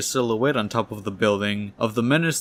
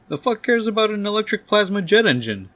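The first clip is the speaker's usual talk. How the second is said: high, exasperated outburst; angry outburst; 11 semitones higher, 4 dB louder than usual. Acted. Doc Ock dismissing the printout, angry outburst. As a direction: explode in high-pitched disbelief. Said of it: "Doctor Octopus gets incredibly angry".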